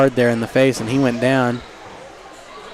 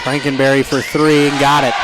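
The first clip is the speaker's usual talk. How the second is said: excited shout. Real, not acted. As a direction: shout it as the shot drops. Live call, three-pointer made.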